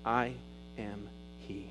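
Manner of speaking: very soft, low, slow reveal; a hushed I am he; hushed revelation. Retold or imitated. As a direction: let it drop to almost nothing. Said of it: "looks into the eyes ... and says".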